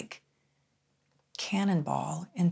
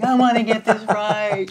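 loud, high, over-eager pupil; hammed-up desperation to please; 5 semitones higher, 10 dB louder than usual. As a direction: blurt it out, imitating your keen younger self. Acting her eager guessing.

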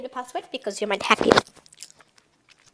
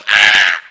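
startled yelp; 9 dB louder than usual. Real, not acted. Startled cry after singing.

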